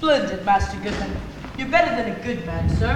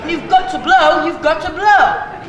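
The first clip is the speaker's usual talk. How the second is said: loud; 5 semitones higher, 7 dB louder, slower than usual. Acted. Servant urging loudly.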